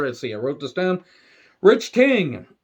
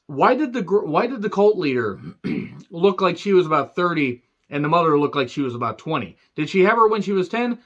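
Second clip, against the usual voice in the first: usual speaking voice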